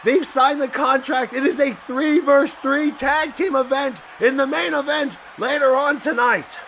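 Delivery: loud, high wrestling announcer call; hyped ring announcer. Acted. Announcer calling the match to viewers, loud hype. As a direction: sell the main event to the crowd.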